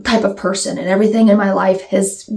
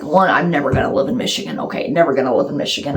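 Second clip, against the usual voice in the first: low, quick, quieter aside; dismissive refusal; 6 semitones lower, 3 dB quieter, faster than usual. Retold or imitated. Tropical girl ruling out Michigan, dismissive. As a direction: rattle it off low as a firm no. Acts her past self's refusal.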